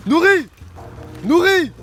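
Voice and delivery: loud high shout across the lake; calling out. Real, not acted. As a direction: yell a name to someone far away. Appelle un ami perdu. (appel crié au loin)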